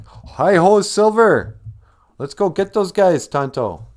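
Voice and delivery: loud, high cowboy hero call to charge; heroic rallying cry. Retold or imitated. Lone Ranger calls Tonto to chase the bad cowboys, loud heroic cry. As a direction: belt it out like a hammed-up Lone Ranger rallying his sidekick. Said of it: "the Lone Ranger was like".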